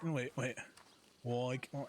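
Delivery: usual speaking voice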